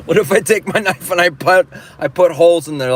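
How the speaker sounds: high, loud, insistent pitch; exaggerated mock pleading. Traveler begging to cut holes in the carrier, exaggerated pleading. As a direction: push it up high and loud, half-amused at your own idea. Repeats the desperate plea after laughing.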